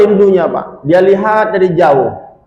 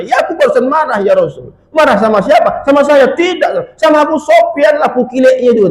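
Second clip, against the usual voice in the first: angry retort; 10 semitones higher than usual. Acted. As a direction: snap back in indignation. Angry Romlah answers, shouting. (Romlah menjawab dengan teriakan marah)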